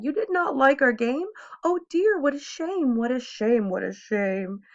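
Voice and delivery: high, sing-song fading shame; mock sad cat. A narrator's reading. Cat leaves the children, high and falling sadly. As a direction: let the shame fade out. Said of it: "a sad kind of look".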